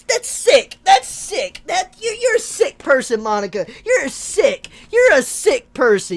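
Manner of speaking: high, loud, wailing indignation; mad. Real, not acted. Player scolding Monica for interrupting, mad and loud. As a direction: stammer in outrage, pitch leaping up. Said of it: "I'm mad".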